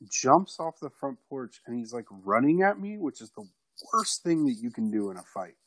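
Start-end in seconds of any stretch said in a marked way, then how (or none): none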